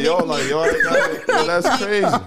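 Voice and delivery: high, loud laughing quote; laughing retelling. Retold or imitated. Retelling his own reaction while laughing, high and loud. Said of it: "I had to break it down and be like".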